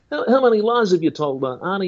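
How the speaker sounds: high pushy preacher questioning; Ray Comfort voice. Retold or imitated. Ray Comfort quizzes Arnie, high and pushy. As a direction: pitch it up and press him. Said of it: "Ray Comfort ... good person test".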